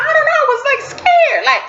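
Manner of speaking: very high, squealed confession; mock scared. Nervous before seeing her husband, scared. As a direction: squeak it out in hammed-up fright. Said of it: "I felt scared".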